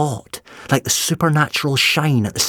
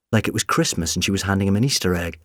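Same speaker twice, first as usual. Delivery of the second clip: usual speaking voice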